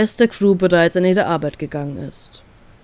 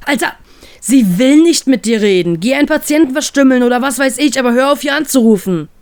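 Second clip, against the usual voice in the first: higher, forceful, fed-up telling-off; angry rebuff; 5 semitones higher, 4 dB louder than usual. Acted. Friend on the phone orders the captain to stop calling, angry. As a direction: tell the caller off, loudly exasperated.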